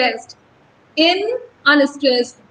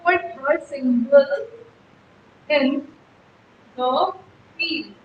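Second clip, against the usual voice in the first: slow, broken up, with long pauses; spaced-out reading, fading softer at the end; syllable-by-syllable scansion; 6 dB quieter, much slower than usual. Shown as a demonstration. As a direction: dole out "behold her single in the field" piece by piece. Teacher scans the line by syllables.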